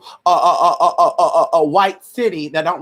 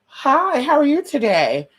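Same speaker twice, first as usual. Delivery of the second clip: pitched-up polite hello; high friendly greeting; 7 semitones higher, slower than usual. Shown as a demonstration. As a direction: sing it out high and sweet. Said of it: "they might look at you like".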